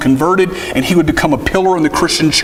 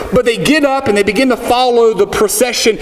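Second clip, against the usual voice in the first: ordinary talk, nothing marked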